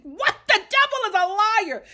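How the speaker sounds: high, loud disbelieving laugh and exclaim; outraged disbelief, loud; shocked outburst. Real, not acted. Reacting to how Tiffany talks about her husband, loud shock.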